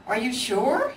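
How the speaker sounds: small worried cub, rising; anxious little cub checking; little bear voice. Acted.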